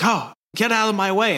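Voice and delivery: hammed-up spoiled royal; mock haughty prince.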